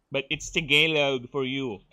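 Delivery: normal talking voice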